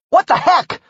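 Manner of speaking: high loud startled yell. Acted. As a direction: shout it as glass explodes. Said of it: "I yelled".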